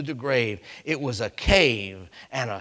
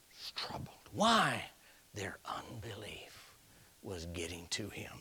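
very soft, half-whispered groaning words; heavy, hushed pulpit aside; hushed, troubled; 10 dB quieter than usual. Acted. Preacher acts out groaning in spirit.

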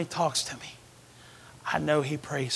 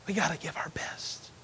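much softer whispered repeat; 8 dB quieter than usual. Shown as a demonstration. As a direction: say it again, only whispering. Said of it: "repeated in whisper".

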